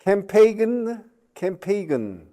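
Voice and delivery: Campagen, each letter sounded, slowly: kam-PAY-gen; read as spelled. Shown as a demonstration. Teacher mocking English spelling: Campagen read letter by letter.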